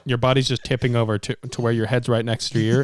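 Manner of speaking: ordinary talk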